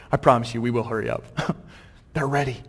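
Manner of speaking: ordinary talk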